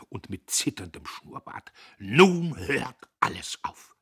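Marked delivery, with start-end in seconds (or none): 2.0-4.0 slow, loud and higher; scandalised, heavy shout; outraged shout. A narrator's reading. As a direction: cry out in drawn-out indignation. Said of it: "schrie er".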